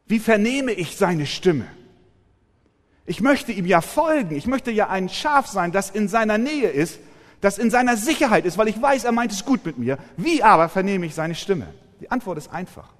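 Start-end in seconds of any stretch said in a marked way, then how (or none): 0.1-11.7 louder, higher pleading sermon voice; fervent preaching. Acted. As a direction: cry out the longing to the congregation. Preacher builds to fervent plea.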